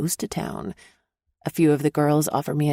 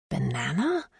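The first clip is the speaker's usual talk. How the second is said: puzzled question; 2 semitones lower, 5 dB quieter than usual. Acted. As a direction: echo "Banana?" in quiet confusion. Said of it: "The woman looks at me and grins".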